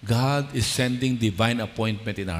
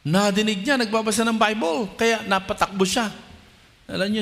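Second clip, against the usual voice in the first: full-force preaching; 8 semitones higher than usual. Acted. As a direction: drive the promise home to the congregation.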